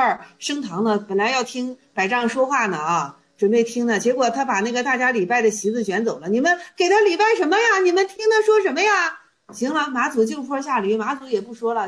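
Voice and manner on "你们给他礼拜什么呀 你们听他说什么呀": high-pitched mock scolding of worshippers; acted challenge. Teacher voices the master challenging the bowing crowd, indignant. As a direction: challenge them in a raised, quick voice.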